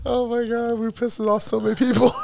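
high, laughing through the words. Real, not acted. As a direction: giggle while talking. Laughs about pissing people off.

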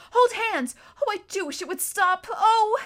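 high, loud, alarmed pleading; anxious wail ending in a loud cry; distressed cry. A narrator's reading. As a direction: call out in panic as you are pulled. Susan calling out amid magical pull.

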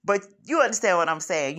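normal talking voice

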